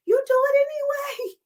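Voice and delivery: high squeaky emphasis; sudden falsetto punch; squealed high. Acted. Coach's high squeal on key line.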